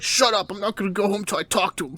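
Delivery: hammed-up defiance; mock angry retort. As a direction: shout back in playful exasperation. Heroine snaps back at the crows.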